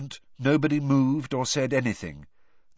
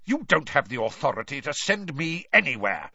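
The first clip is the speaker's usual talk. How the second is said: usual speaking voice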